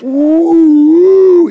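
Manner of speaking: high, loud wind howl imitation. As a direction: imitate a gale with a long woo. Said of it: "the howling winds".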